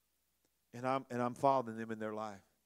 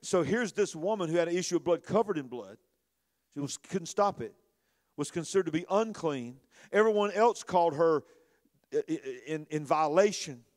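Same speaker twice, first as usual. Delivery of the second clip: louder, impassioned sermon delivery; preaching at full force; 6 semitones higher, 9 dB louder than usual. Acted. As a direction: preach it hard to the congregation.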